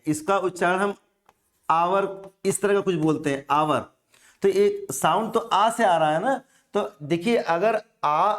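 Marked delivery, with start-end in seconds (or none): 1.7-3.8 आवर said with an open a and no h; hour without h. Shown as a demonstration. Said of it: "इसका उच्चारण हम ... बोलते हैं".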